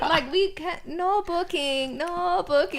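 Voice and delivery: put-on high, slow broken English accent; bad Thai accent. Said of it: "It was the worst accent".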